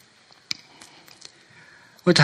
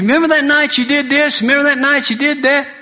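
high, loud, eager ribbing; excited teasing; 12 semitones higher, 4 dB louder than usual. Retold or imitated. Old friend Terry recalling wild nights, eager high teasing. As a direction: needle an old friend with glee, pitched high.

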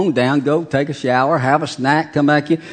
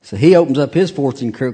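ordinary talk

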